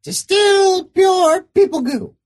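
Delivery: high proud little creature; squeaky creature voice. Acted. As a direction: announce it like a proud critter. Creature proudly names goo.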